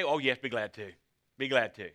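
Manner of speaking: offhand, breezy yes; flippant. Pretend. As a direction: brush it off casually. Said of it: "you could flippantly say".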